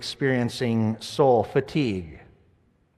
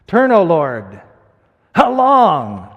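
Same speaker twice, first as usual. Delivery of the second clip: anguished cry; 6 dB louder, slower than usual. Acted. Crying out psalm at full voice.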